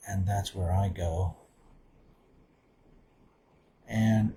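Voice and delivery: soft, hushed meditation voice with long pauses; guided meditation. Real, not acted. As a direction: settle quietly inward, leaving space between phrases.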